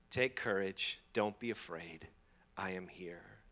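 low, soft word of comfort; reassure, sinking to a near whisper; gentle reassurance. Acted. Delivering the Lord's comforting word.

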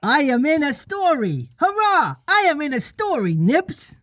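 put-on very high, piping childish glee; high, excited boy voice; thrilled little boy. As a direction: squeal it out as a delighted child. Tootles thrilled to be included.

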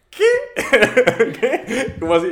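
high, loud, laughing disbelief; retelling her baffled reaction, laughing high and loud; laughing confusion. Retold or imitated. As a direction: squeal the question through a laugh. Friend confused, retold with laughing.

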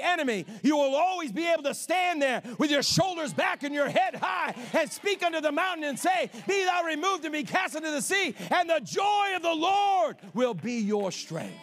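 loud, high, rising preaching shout; preaching climax. Real, not acted. Preacher declaring victory over the enemy, loud shout. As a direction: build it up and shout it home.

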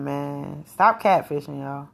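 ordinary delivery

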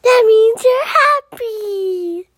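high, loud, drawn-out joy; delighted unicorn. Acted. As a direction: sing out the good news slowly. Unicorn delighted by dragon's happiness.

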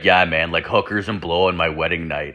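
low, falling, resigned admission; acted-out sheepish buddy owning up; matter-of-fact confirmation. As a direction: confirm it like it's no big deal. Friend matter-of-factly confirms it.

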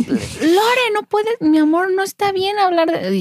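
gentle correcting father. Retold or imitated.

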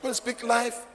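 high, punchy pulpit declaration; rousing preaching. Real, not acted. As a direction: proclaim it to stir the congregation. Preaches declaration at full force.

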